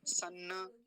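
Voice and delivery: सन्न with a plain dental n; wrong dental n. Shown as a demonstration. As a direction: flatten the retroflex into n in सन्न. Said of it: "ऐसा न जैसा नहीं है".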